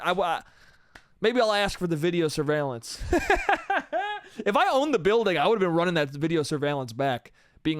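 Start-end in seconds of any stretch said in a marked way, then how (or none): 3.0-4.4 high laugh. Real, not acted.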